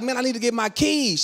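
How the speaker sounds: high, insistent retort; frustrated plea. Retold or imitated. Husband wanting keys from wife, frustrated. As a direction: argue it up at a closed door. Husband arguing through door.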